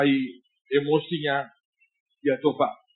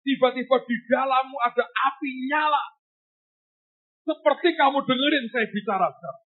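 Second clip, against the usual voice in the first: emphatic prophecy; 10 semitones higher than usual. Retold or imitated. Preacher quoting prophecy with force.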